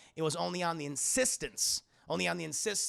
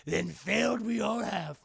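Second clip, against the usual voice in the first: Yoda scolding, slow and getting louder; 4 semitones higher, 5 dB louder than usual. Retold or imitated. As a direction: scold him bitterly.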